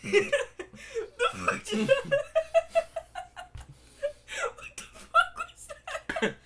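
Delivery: strained high laugh, still choking; laughing after choking. Real, not acted. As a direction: laugh through a coughing fit. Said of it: "went down the wrong pipe".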